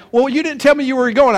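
high, loud mock protest; protesting son. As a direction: imitate an indignant kid. Acting out the teenage son's protest.

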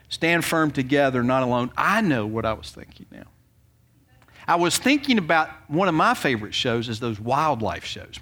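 ordinary talk, nothing marked

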